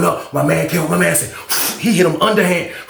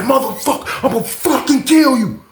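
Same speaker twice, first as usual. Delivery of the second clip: high-pitched, enraged threat; imitated snapping; 8 semitones higher than usual. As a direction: act out a man losing it and threatening. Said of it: "he's like, he's snapping".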